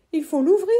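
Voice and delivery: high, loud urgent cry; urgent shout. Acted. Boy shouting to his uncle to open the tree, loud. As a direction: shout for help. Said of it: "ai-je crié à Julius".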